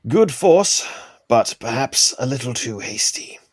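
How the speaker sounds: measured, lightly put-on character voice; Oliver. Oliver critiquing his sparring partner's strike, in character. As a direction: play a composed fighter giving a verdict. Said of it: "Oliver's like".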